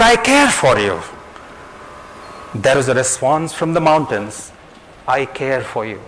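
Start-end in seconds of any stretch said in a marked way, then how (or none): 0.0-1.0 put-on high-pitched child's call; storyteller's high voice for a little girl. A narrator's reading. Child calls out to mountain.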